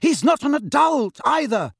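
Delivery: flustered, angry contradiction; heated retort. A narrator's reading. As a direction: fire back, pitch jumping up. Heated argument, colour rising.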